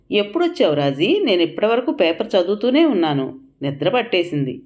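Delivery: usual speaking voice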